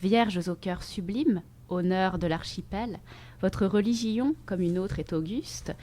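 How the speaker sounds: soft, measured poem recitation; poetry reading. Acted. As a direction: read the verses softly, letting each phrase settle. Reads a poem aloud. (lecture de poème, posée et douce)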